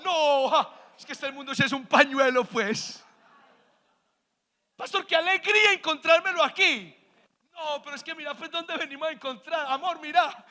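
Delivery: overjoyed to bump into him; delighted surprise. Retold or imitated. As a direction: church member excitedly greets his pastor abroad. Church member delighted to meet pastor.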